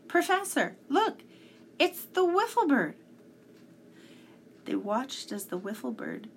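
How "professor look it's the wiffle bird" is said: high, loud excited shout across the distance. A narrator's reading. Tom shouting to the professor on spotting the bird, loud and high. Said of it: "Then he shouted".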